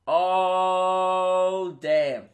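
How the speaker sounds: long, sustained swooning "oh", then a drop; smitten reaction. Retold or imitated. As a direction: stretch the first word right out, hammed-up awe. Exaggerated swoon at hot doctor.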